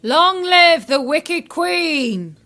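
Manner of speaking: slow, drawn-out hail; called-out password. Acted. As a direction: call out a loyal salute, slowly. Guard calls back the password.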